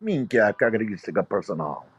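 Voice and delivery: low, fast fake Italian mutter; hammed-up Italian accent; mock Italian gibberish. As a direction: rattle off fake Sicilian.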